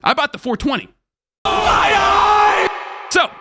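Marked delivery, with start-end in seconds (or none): 1.4-3.3 shocked shout. Retold or imitated. Shocked realisation.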